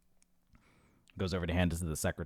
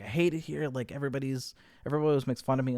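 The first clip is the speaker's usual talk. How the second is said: higher-pitched retold griping; upset complaint; 7 semitones higher, faster than usual. Unhappy boy complaining to his brother about being mocked, upset complaint. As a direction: act out a mild, pleading grievance.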